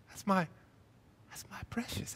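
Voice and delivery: breathless hush over a treasure; secretive whisper; whispered my precious. Acted. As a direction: whisper it like a hoarder clutching a prize. Hoarding pet sin, Gollum-like.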